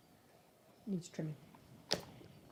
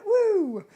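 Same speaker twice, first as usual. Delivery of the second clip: playful woo; 12 semitones higher, 12 dB louder than usual. Acted. Imitating a sound.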